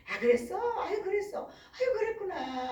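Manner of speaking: put-on high, singsong replies to a baby; motherly cooing. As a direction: coo back as an attentive mother. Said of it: "막 이렇게 이야기를 해줘야 되는데".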